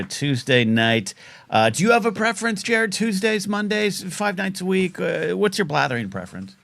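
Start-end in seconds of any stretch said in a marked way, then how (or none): 1.5-6.6 game show host. Acted. Host voice to the caller.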